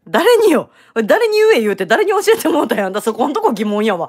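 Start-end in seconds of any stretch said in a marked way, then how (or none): 0.1-2.9 loud, high teasing demand, fast at the end. Real, not acted. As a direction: pounce on him: who taught you that?. Teasing who taught him that.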